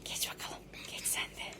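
whispering. Real, not acted. Sneaking to eat cereal silently.

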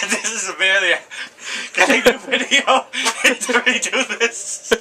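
breaking up with laughter mid-take; laughing. Real, not acted. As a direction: laugh through the question.

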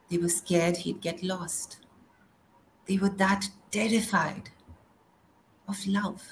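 ordinary delivery

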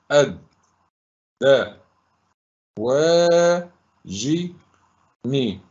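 each syllable alone, long pauses between. Shown as a demonstration. Teacher breaking a word into syllables for pupils, sounded out. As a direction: sound it out, holding the long vowels. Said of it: "سأقولهم مرة أخرى".

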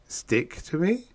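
slow, high, rising question; gulping alarm. A narrator's reading. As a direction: echo it back in mild dismay. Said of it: "I gulped".

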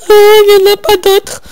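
loud, high dismayed cry; surprised cry. Real, not acted. Disappointed outburst.

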